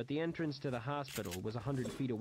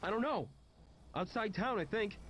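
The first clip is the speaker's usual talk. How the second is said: higher-pitched, halting character voice; lightly voiced John, uncertain; 9 semitones higher than usual. John answers his friend about Amy, lightly voiced and unsure. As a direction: start high, then falter into second thoughts.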